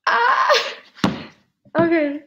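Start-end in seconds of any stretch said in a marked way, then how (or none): 0.1-0.7 high, loud squeal of delight; excited shriek. Real, not acted. Writer unboxing her own printed novel, squealing with joy. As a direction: let out a thrilled squeal.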